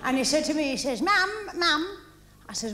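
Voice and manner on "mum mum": high-pitched retold call for Mum's attention; child calling mum.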